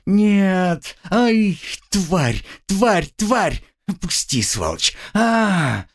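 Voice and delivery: high screaming curses; pained screaming. A narrator's reading. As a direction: scream high through the pain. Girl screams in pain.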